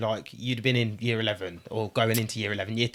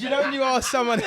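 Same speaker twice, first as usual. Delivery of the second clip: laughing through the words; can't hold back a giggle; 14 semitones higher than usual. Real, not acted.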